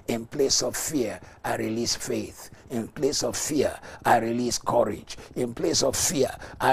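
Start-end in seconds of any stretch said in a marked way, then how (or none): none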